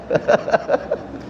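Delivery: real chuckling at own joke; amused, breathy run of chuckles; laugh. Real, not acted. Laughs at own joke about marrying.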